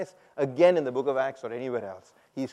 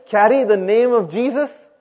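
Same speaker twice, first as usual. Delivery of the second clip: loud, raised, forceful prompt to a congregation; rousing preacher's call for a stronger response; emphatic rallying call; 5 semitones higher, 10 dB louder than usual. Shown as a demonstration. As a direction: call it out with full conviction. Prompts again after unconvinced response.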